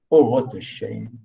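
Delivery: ordinary talk, nothing marked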